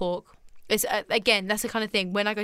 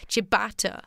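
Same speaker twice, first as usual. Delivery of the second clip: ordinary talk, nothing marked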